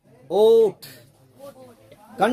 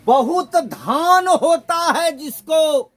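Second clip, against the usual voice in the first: बहुत धान होता है जिसको slow, louder and higher; emphatic definition; 4 semitones higher, 4 dB louder, slower than usual. Shown as a demonstration. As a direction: lay out the meaning slowly with weight. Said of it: "ध्यान देंगे". (बहुत धान होता है जिसको धीमे और ज़ोर से)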